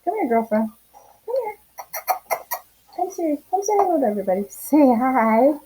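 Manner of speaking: sing-song coax to a shy dog; calling the dog. Real, not acted. Coaxing dog to greet viewers.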